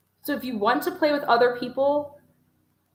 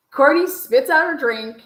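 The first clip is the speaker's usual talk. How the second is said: loud burst; 3 semitones higher, 7 dB louder than usual. Real, not acted. Courtney spits out her drink.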